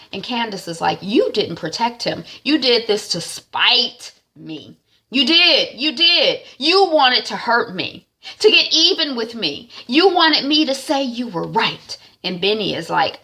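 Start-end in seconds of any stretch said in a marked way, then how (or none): 1.0-12.0 angry accusation. Retold or imitated. Mother accusing in quarrel.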